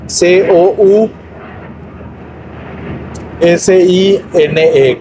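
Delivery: each letter of "C O U S I N E" named slowly, one by one; spelled out letter by letter. Shown as a demonstration. Teacher spelling a German word for learners, letter by letter. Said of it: "cousine se escribe".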